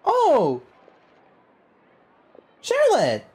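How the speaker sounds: high, loud delighted call; light up and call her name; bursting happy hello; excited greeting. Real, not acted. Streamer greets game character.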